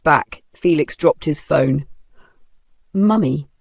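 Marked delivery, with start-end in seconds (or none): none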